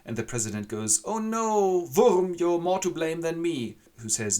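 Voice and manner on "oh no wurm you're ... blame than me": high, loud, slow theatrical wail; hammed-up anguish. The president wails blame at Wurm. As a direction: ham up the blame.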